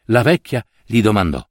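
ordinary delivery, nothing marked

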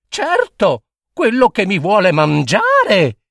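put-on high gentle child pleading; consoling little girl. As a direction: comfort daddy sweetly. Lucilla consoling her crying father.

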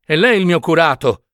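a touch of desperate pleading. A narrator's reading. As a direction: beg him as her true priest. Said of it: "supplicò Nunziata".

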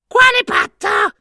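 very high screechy question; suspicious hag. A narrator's reading. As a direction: squawk the question like a crone.

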